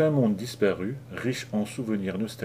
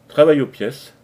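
quick, loud and sharp; a quick bright comeback; brisk retort; 4 semitones higher, 11 dB louder than usual. A narrator's reading. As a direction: toss it back briskly. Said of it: "lança-t-elle vivement".

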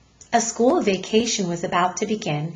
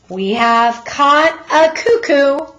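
mocking policeman; 6 semitones higher, 8 dB louder, slower than usual. Policeman mocks the mother.

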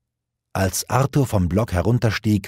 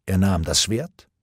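ordinary delivery, nothing marked

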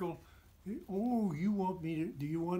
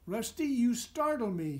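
startled, higher and louder complaint; a touch of wolfish grumbling; complaining; 7 semitones higher, 5 dB louder than usual. Acted. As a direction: protest at being startled. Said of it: "Snap complained".

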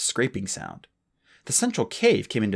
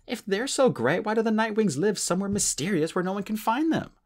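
put-on higher, teasing young dragon; lightly voiced Clay; 10 semitones higher than usual. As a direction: lightly poke fun as Clay.

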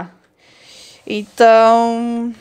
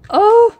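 high, loud "oh"; short high shout of shock; startled cry; 12 semitones higher, 7 dB louder than usual. Real, not acted. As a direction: yelp out in sudden alarm. Reaction to game mishap.

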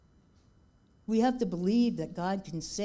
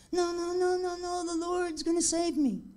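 higher, insistent mock refusal; stubborn refusal; 11 semitones higher than usual. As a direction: wave off the rescuer stubbornly in a raised voice. Stubborn man refuses again.

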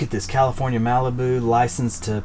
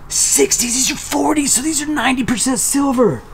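much higher, giddy delight; excited; 11 semitones higher than usual. Real, not acted. Vlogger sorts ninety percent silver coins, high giddy delight. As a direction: squeal with joy over the haul.

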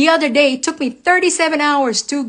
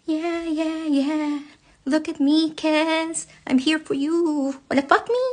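higher, softer teasing come-on voice; 4 semitones higher, 5 dB quieter than usual. Retold or imitated. Mocking the girl who sent her husband a naked video, higher and soft. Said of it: "sent him a naked video dancing".

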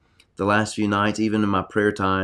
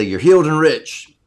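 loud, high exuberant proclamation; shouted blessing in place of "bless you"; yelled out loud; 7 semitones higher, 9 dB louder than usual. Shown as a demonstration. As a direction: yell it out so anyone around hears. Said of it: "I yell it out loud".

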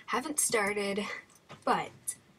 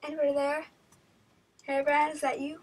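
raised, louder searching call; nervous calling out; 5 semitones higher, 6 dB louder, faster than usual. Real, not acted. Player calling to whoever is there, nervous call. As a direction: call out warily for company. Player calls into the empty game.

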